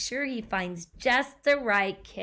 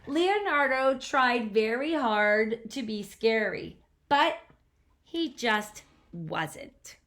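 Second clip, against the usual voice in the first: put-on lively read-aloud to a child; storybook narration; 5 dB louder, slower than usual. A narrator's reading. Parent reading a picture book to a child, animated narration. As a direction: tell the story with bounce.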